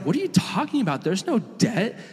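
high-pitched, disbelieving acted protest; incredulous father. Dad waves away his son's debt, incredulous. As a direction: brush the apology aside in happy disbelief.

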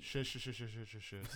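rapid string of hushes in character; acted hushing; urgent shushing. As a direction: shush them over and over, cutting them off. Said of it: "This is Vasquez".

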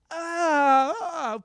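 high, loud hammed-up moan; mock moan. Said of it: "That's how I sound".

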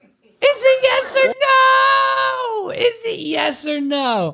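very high, drawn-out yell; mock shouted ultimatum. Host parroting the guest's ultimatum, high mock shout. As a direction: wail the demand, then ease slightly.

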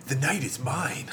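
put-on cartoon hero voice; theatrical character voice; Dean Venture. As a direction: proclaim it like a cartoon avenger. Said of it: "the Dean Venture voice".

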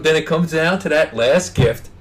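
ordinary delivery

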